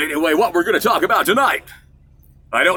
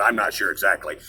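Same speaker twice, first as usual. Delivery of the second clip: usual speaking voice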